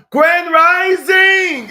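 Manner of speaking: high, loud, stretched-out shout; big drawn-out morning cheer; rousing greeting call. Real, not acted. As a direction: holler it slowly to all the viewers. Signature greeting called to viewers.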